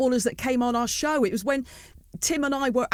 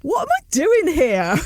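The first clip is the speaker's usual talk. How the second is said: louder, higher, drawn-out mock incredulity; mock disbelief; 4 semitones higher, 6 dB louder, slower than usual. Interviewer voicing imposter syndrome in a star-filled room, loud mock disbelief. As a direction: act out a loud wave of self-doubt. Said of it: "Do you ever stand there and go".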